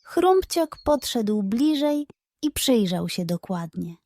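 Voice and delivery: ordinary delivery, nothing marked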